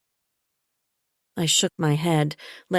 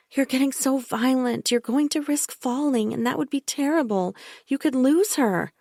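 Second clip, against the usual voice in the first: higher, falsely sweet and insistent; a sickly-sweet caretaker who won't be argued with; Bella, falsely soothing; 8 semitones higher than usual. A narrator's reading. Bella insisting to the narrator that she hurt Michael, falsely soothing.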